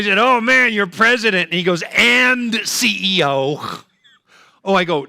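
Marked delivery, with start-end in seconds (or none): 0.2-1.5 higher, louder wow; impressed. Retold or imitated. Comedian impressed by Tyler, higher and louder. 2.0-3.8 slow, spelled-out letters; pointed correction. Retold or imitated. Tyler slowly adding his title.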